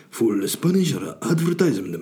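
hammed-up Spanish advert voice; put-on Spanish accent. Podcaster mocking his own full Spanish advertisement, fake Spanish accent. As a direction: do a Spanish accent as if selling something. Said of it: "I don't know why I went, uh, full Spanish".

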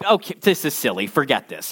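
low, softening throwaway mutter to himself; dismissive aside. Retold or imitated. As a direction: brush it off, voice dropping away. Inner voice dismissing the letter.

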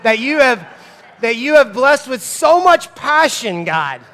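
loud, high, effortful praise; straining prayer. Acted. Dad praying about his energetic boys, straining to be thankful.

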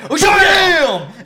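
high, loud shouted oh damn; mock shout. Friend reliving the pencil trick, loud mock shout. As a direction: yell it like you can't believe it.

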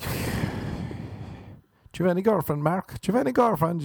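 high, prying question; nosy adult. Retold or imitated. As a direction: tease the boy about girlfriends. Voicing nosy Irish adult.

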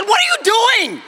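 high, alarmed shout, slowly; mock yell. Husband yells at his wife with the pillow, high mock shout.

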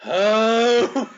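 long, loud, high "Oh!"; crowd's answering shout. Retold or imitated. Co-host mimics the crowd responding to Duggan, drawn-out answering shout. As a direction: echo the wrestler as a whole crowd would. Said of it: "and the crowd is going".

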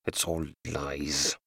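low, soft and slow mutter. Acted. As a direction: mutter it to himself, rocking.

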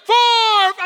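high, full-volume retold scream; screamed counting. Husband joining the doctor's count, mock scream. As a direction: scream the number along with her. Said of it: "screaming with her now".

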